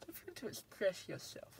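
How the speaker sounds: soft, badly faked Persian accent. Car vlogger reads out a drive mode slogan, bad Persian accent. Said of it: "That was a really bad Persian accent".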